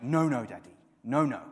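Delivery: put-on loud, insistent little boy's no, no; a small boy's firm double no; toddler protest. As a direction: stamp it out like a serious toddler. Toddler son protesting, voiced.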